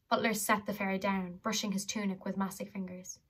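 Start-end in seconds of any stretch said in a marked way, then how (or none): none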